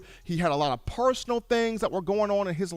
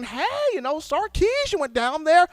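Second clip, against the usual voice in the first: much higher, mock-gushing praise; suddenly impressed fans; 13 semitones higher than usual. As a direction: rave about him, pitch climbing high. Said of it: "got everybody saying".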